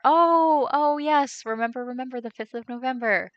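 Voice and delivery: high, loud burst of delighted recognition; oh-yes moment, pitch jumping up; excited recognition. Real, not acted. Streamer finally recognises the holiday a viewer mentions, excited.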